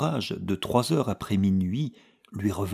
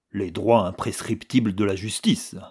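usual speaking voice

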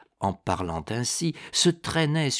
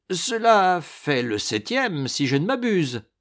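smiling; 6 semitones higher, 3 dB louder than usual. A narrator's reading. Ragastens teases the wounded baron, smiling. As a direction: savour the score with a smile. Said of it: "avec un sourire".

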